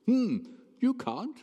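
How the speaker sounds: high, boastful mock voice with a laugh; mocking smug show-off. To the crowd, showing off he can read, high smug mock voice. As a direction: lord it over them like a know-it-all. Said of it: "Bit like Michael McIntyre".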